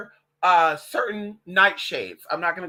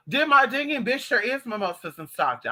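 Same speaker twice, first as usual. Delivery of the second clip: ordinary delivery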